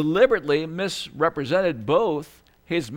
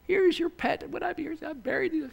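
high, soft whimpering puppy voice; a sad little puppy offering what it dug up; whimpery puppy; 13 semitones higher, 6 dB quieter than usual. Retold or imitated. Preacher imitates puppy returning buried talent, whimpery and sad. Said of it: "this little puppy coming in".

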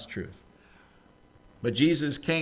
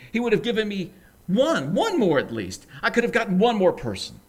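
rising, louder, higher desperate self-reproach; anguished lament; 5 semitones higher, 6 dB louder than usual. Acted. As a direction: build the regret line by line. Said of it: "goes on with his lament".

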